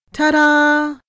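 high, loud ta-da; loud, high-pitched fanfare; triumphant shout. A narrator's reading. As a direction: shout it like a big reveal. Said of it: "she shouted".

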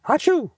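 a high, comic mock sneeze; big sneeze. A narrator's reading. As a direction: blast out a cartoon achoo. Sneeze in stinky facts segment.

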